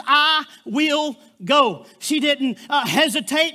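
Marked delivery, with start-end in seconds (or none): 0.0-1.9 each word drawn out and certain; the bride answers slowly, with no doubt at all; slow, firm "I will go". Retold or imitated. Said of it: "Three words".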